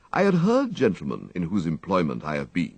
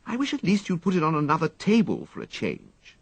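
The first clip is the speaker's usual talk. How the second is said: higher, peevish gentleman's voice; sulky young master grumbling; petulant young toff; 11 semitones higher than usual. A narrator's reading. Wooster's voice in story.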